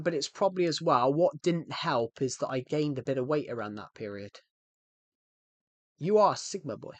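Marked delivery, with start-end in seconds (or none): 6.0-6.9 botched Irish lilt, drifting elsewhere; attempted Irish accent. Shown as a demonstration. Streamer trying an accent for chat, botched Irish. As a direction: have a shaky go at an Irish accent. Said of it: "I tried to do Irish".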